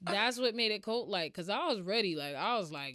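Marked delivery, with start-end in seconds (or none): none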